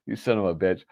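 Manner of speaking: usual speaking voice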